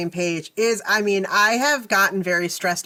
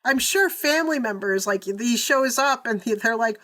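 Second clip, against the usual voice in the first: ordinary delivery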